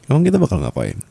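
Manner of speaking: playful laughing repeat; laughing tease. Acted. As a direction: tease again while laughing.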